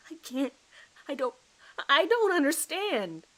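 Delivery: high, loud, cracking bewilderment; panicked confusion. Acted. Suspect facing murder charges, high and panicked. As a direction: cry out that none of it makes sense.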